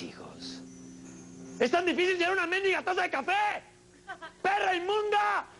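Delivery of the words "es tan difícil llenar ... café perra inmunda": high shrill furious yell; hammed-up high-pitched tantrum; mock rage. Raging at slow waitress.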